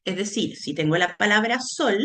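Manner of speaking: ordinary delivery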